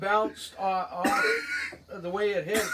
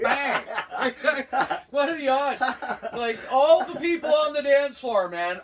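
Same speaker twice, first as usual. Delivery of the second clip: louder and higher, laughing through the words; 4 semitones higher, 6 dB louder than usual. Real, not acted. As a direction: tell the unlucky bit while cracking up. Laughing at his bad luck.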